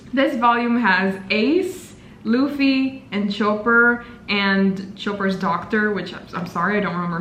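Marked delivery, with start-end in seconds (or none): none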